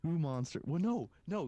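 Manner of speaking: ordinary delivery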